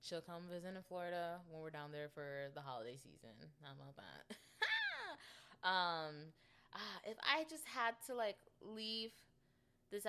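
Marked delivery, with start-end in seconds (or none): 4.6-5.6 short high-pitched burst of laughter; one high squeal of a laugh. Real, not acted. Cracking up at her own remark, high laugh.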